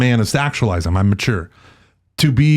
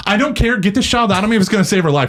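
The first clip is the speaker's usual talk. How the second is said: much higher, urgent mock plea; desperate wife; 8 semitones higher, faster than usual. Host voicing his wife pleading with the doctor. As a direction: insist fiercely, fast. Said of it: "And Shannon goes".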